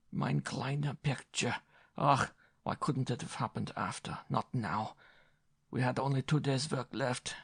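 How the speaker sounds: put-on slow, high German-accented lament; lamenting German director. A narrator's reading. German director lamenting his ruined picture, German accent. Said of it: "he was lamenting".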